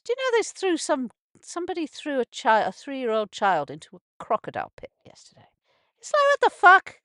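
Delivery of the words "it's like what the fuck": real shocked outrage, high and loud; outraged outburst. Real, not acted. Vlogger reacting to news of hurt child, loud shocked outburst. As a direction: blurt it out in disbelief.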